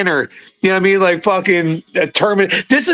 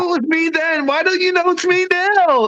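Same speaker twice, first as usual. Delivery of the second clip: mock-indignant customer at the counter, pitched much higher; exasperated customer; 8 semitones higher than usual. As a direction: play the baffled customer pleading with the clerk. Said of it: "you go there, like".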